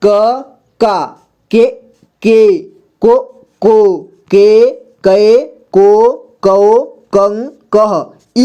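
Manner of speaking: slow क series, each syllable apart; syllable drill. Shown as a demonstration. Teacher shows learners how to read क with vowel signs, slow recitation. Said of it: "तो इसे कैसे पढ़ेंगे?".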